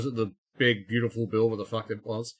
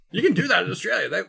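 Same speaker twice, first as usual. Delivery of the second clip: usual speaking voice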